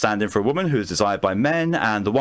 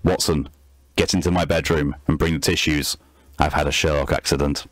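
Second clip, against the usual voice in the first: put-on a lightly done Sherlock Holmes accent, lower; 6 semitones lower than usual. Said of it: "Sherlock accent now sounds like".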